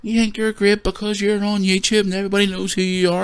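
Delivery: sneering impression of a bar heckler; mocking the aggressive guy. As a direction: put on a silly high voice for the troublemaker. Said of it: "this is the way he talk".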